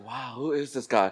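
lightly imitated awed woman, low and soft; mock admiring wonder. Woman swooning over his long hair, mock admiring wonder. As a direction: softly mimic her being taken with him. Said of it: "I don't think it was 'cause his long hair".

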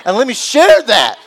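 bright, loud praise; excited gratitude. Pretend. New employee praising God, excited loud gush. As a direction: gush about God's goodness.

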